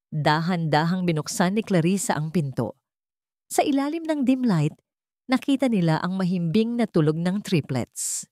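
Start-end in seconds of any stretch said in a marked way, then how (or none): none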